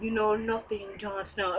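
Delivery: put-on "You know nothing" in a soft, quiet Ygritte accent; Ygritte's accent. A vlogger imitates Ygritte to viewers in her accent. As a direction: lightly put on the wildling accent, hushed. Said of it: "your girl got an accent".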